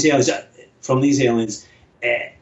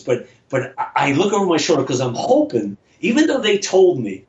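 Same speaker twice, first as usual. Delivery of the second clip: normal talking voice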